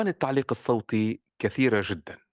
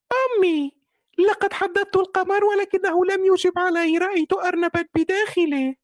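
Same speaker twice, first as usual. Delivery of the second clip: put-on very high, loud child bunny complaining; little rabbit voice; 6 dB louder than usual. Shown as a demonstration. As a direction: whine it like a small upset rabbit. Young rabbit answers his mother.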